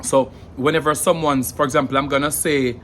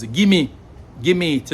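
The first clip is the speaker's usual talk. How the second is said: forceful gimme; 5 semitones higher, 4 dB louder, slower than usual. Shown as a demonstration. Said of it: "whenever someone says".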